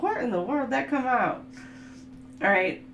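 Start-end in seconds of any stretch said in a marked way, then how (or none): 0.0-1.4 higher-pitched baffled question; playfully astonished; mock bewilderment. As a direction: act out puzzlement at yourself. Acts out surprise at own accent.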